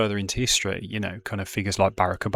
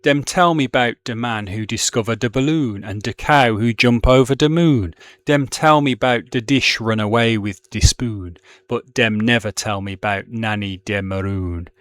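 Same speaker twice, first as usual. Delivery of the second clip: louder rhythmic reading with Caribbean-dialect words; poetry reading in dialect; 5 dB louder than usual. Shown as a demonstration. Teacher reading the last verse to the class, in rhythmic dialect. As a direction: lean into the list with emphatic beats. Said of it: "I will just read it in my, my Brummie accent as best I can".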